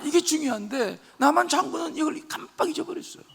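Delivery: usual speaking voice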